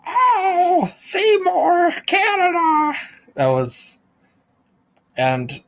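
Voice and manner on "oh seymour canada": much higher, louder cartoonish squeal; hammed-up shrill-pitched cartoon cry of the name; squeaky cartoon voice. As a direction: squeal it like a Simpsons gag character. Simpsons-style joke voice.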